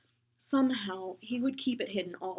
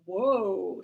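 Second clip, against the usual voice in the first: mock wow; 9 dB louder than usual. Bully reacts to the sketch.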